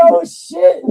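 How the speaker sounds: very high and loud "Oh, shit", half laughing; laughing shock. Retold or imitated. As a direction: top the first one, cracking up. Repeated, bigger shocked reaction.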